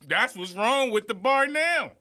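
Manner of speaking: ordinary delivery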